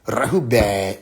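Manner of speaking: Rahubat said low and drawn out; irritating way. Retold or imitated. Mocking people who say the word annoyingly. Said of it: "people who literally say Rahubat like that".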